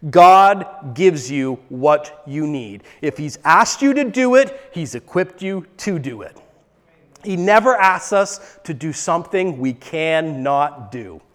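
usual speaking voice

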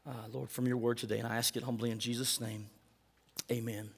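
soft, humble prayer voice. Real, not acted. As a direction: pray quietly and humbly to the Father. Pastor leads closing prayer.

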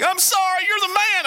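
very high-pitched wailed apology; hammed-up tearful squeal; mock weeping. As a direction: wail it out as a comic replay of crying. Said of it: "I remember just weeping like a".

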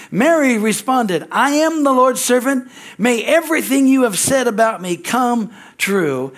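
emphatic scripture reading. Acted. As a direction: proclaim Mary's answer slowly. Reads Mary's answer aloud.

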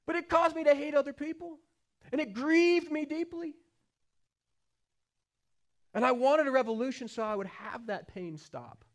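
full-force sermon climb; impassioned preaching. Acted. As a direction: preach it with rising passion from the pulpit.

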